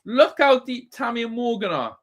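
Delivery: ordinary talk, nothing marked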